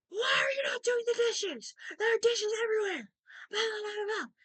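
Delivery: high-pitched mock shriek of a scolding roommate; retold screaming. Roommate yelling at him in the background of a call, mock screaming. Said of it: "just screaming at him".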